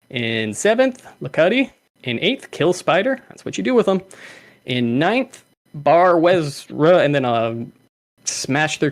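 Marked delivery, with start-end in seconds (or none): none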